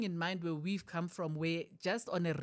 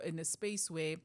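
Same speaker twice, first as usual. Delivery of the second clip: ordinary talk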